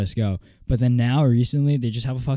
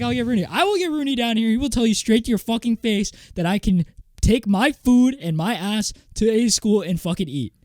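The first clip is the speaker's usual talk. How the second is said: high, raised furious rant at the lunch aide; angry retold rant; 10 semitones higher, 3 dB louder than usual. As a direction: let it all burst out at him. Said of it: "in spite and anger".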